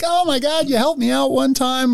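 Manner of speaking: high, gushing thanks; grateful gushing. Retold or imitated. Grateful man thanking the officer, high and gushing. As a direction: gush like someone you once helped. Voicing grateful former arrestee.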